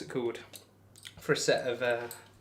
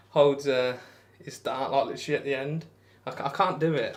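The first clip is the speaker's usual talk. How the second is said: ordinary talk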